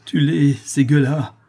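slow, high, loud incitement; distraught outburst; wild urging. A narrator's reading. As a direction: urge him fiercely against them. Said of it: "avec égarement".

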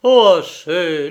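loud, slow, falling cry; exclaimed plea. A narrator's reading. Stello begs the doctor to stop, pained exclamation. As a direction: beg loudly for it to stop. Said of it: "s'écria Stello".

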